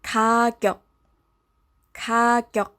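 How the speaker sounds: 가격 spelled out by a teacher, ending on a clipped K; Korean word demo. Shown as a demonstration. Teacher models 가격 for learners, careful clear demo. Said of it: "So the end result".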